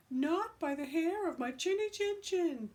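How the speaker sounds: squeaky high pig voice, defiant; little pig shouting back at the wolf through the door; high pig refusal. Acted.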